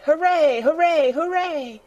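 high, ringing triple cheer; shouted hooray. A narrator's reading. Boy imagined cheering at the found door, shouting. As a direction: cheer high and loud, easing off on the last. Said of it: "would probably have shouted".

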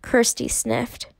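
ordinary delivery, nothing marked

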